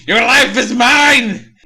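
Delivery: high, loud, slow hammed-up threat; slow, high, loud mock-evil yell; villain shout. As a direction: roar it like a cartoon demon. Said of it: "answers by saying".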